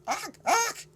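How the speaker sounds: high loud animal grunts, ach ach; baboon grunt. Acted. The baboon grunts back at Amos, high ape noises. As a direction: bark it out like an excited ape. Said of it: "The baboon grunted".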